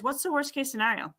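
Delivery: normal talking voice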